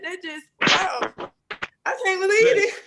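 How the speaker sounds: choked up with happy shock; tearful joy breaking through; overwhelmed. Real, not acted. Overwhelmed caller thanking hosts.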